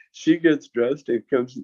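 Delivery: usual speaking voice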